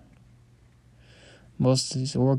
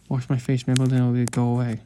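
ordinary delivery

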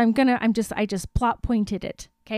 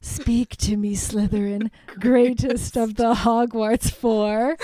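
Tom Riddle; 2 semitones lower than usual. Retold or imitated. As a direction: intone it slowly like a summoning villain. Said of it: "Tom Riddle goes".

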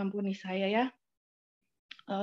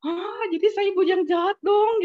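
high, loud mock dismay; raised, indignant-sounding quoted outcry; retold dismay; 8 semitones higher, 6 dB louder than usual. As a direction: act out a taken-aback protest. Retold shocked reaction to the comment.

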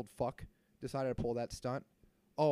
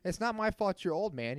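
mock taunt; 7 semitones higher, 4 dB louder than usual. Taunting the old man in the story.

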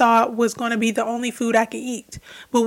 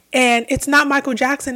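normal talking voice

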